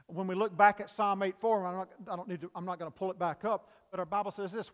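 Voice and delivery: ordinary talk, nothing marked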